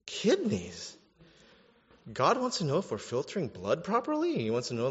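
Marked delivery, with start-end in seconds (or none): 0.0-1.0 higher, startled question; mock surprise. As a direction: act out the listener's shock. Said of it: "And you're like".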